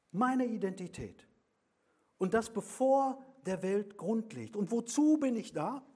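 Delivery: mit voller Kraft, hoch und laut predigen; laute Predigt. Acted. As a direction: ruf es der Gemeinde entgegen. (Prediger ruft der Gemeinde mit voller Kraft zu)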